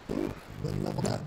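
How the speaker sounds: ordinary talk, nothing marked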